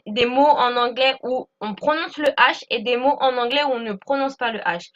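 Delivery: ordinary talk, nothing marked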